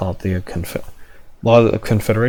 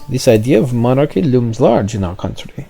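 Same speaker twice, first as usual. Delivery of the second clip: put-on French accent; 3 semitones higher than usual. French official answering in accent.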